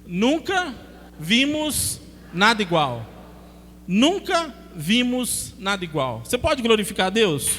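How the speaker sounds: loud, slow, punched proclamation; preaching at full force. Acted. Preacher leads the church in a loud, slow proclamation. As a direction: call it out to the congregation.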